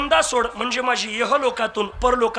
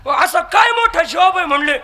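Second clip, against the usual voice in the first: higher, louder insistent question; exasperated demand; 7 semitones higher, 6 dB louder than usual. Acted. Cousin demanding an answer. (चिडून मोठ्याने विचारणे)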